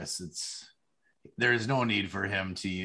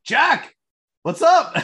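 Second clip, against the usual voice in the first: high, loud hail across a bar; retold shouted greeting; excited call-out; 13 dB louder, much slower than usual. Retold calling out to a celebrity.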